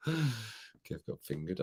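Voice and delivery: very soft, very slow, half-whispered "Kev got fingered"; hushed mock-ominous. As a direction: drop it to a slow, hushed murmur, like a dark joke. Rereading the cheeky comment.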